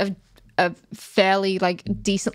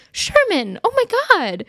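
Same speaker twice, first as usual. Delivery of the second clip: pitched way up, mock doting; high doting girlfriend; 12 semitones higher, 3 dB louder than usual. Mocking Denise fussing over Sherman, high and doting. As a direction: coo his name like a fretting girlfriend. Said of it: "She's always there being like".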